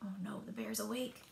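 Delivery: fast, hushed whisper of alarm. Acted. Narrator whispers to young listeners, hushed alarm. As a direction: whisper it like a secret to the kids.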